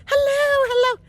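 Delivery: high mock holler back; calling back. Lost woman answering the distant kid, calling back. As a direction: echo the call high, as herself. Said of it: "And I'm going".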